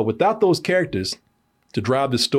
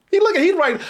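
usual speaking voice

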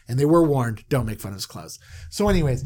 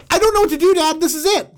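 panicked son; 5 dB louder, faster than usual. Retold or imitated. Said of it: "He goes".

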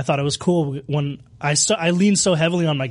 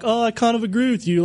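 higher-pitched, meek mock agreement; mock agreeable panelist; 7 semitones higher than usual. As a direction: play a pushover, lightly put on. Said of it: "you want us to all just be here like".

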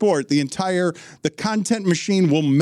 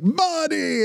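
very high, cartoonish mimicry of an old father; [name]; 13 semitones higher than usual. Impersonator answering the father in kind, cartoonishly high. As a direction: echo the greeting in an exaggerated high voice. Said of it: "[name]'s racist impersonation talking to the actual cartoon character".

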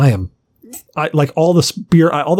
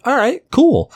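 excited; 11 semitones higher, slower than usual. Retold or imitated. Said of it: "I'm like".

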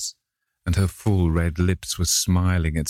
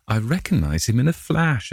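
higher, bright exclamation; exclaiming; 6 semitones higher than usual. A narrator's reading. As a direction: blurt it out with delight. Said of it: "exclaimed the Duchess".

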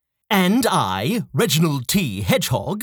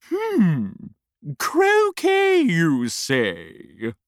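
haughty queen voice; much slower than usual. Acted. Queen voiced, far timbre.

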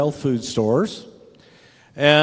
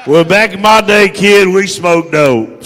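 loud, higher comeback; old-timer's retort; 4 semitones higher, 8 dB louder than usual. Acted. Old-timer answering a kid who teased him, loud indignant retort.

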